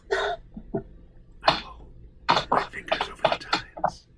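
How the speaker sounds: soft ASMR whisper; breathy hushed words. Shown as a demonstration. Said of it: "Or I could whisper".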